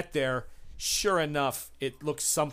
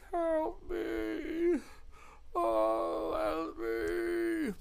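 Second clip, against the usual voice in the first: high, thin pleading cry for help; a desperate captive begging from a cell; high breathy whimpering plea; pleading prisoner; 14 semitones higher, 5 dB quieter, much slower than usual. Acted. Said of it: "instantly you hear".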